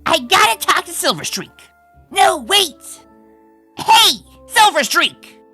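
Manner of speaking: high, loud urgent shout; urgent calling out. Acted. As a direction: call after someone to stop them. Rushing off, calling to rival.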